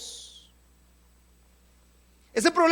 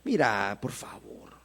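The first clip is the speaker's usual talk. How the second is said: barely audible, begging the problem; hushed, pleading almost to nothing; timid plea; 12 dB quieter, slower than usual. Pretend. Preacher mocks how people beg their problem, whispered. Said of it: "¿cómo le habla a su problema?".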